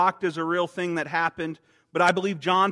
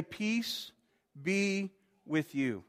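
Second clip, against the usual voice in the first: tender; 3 semitones higher, 8 dB quieter, much slower than usual. Acted. Jesus coming to his heart.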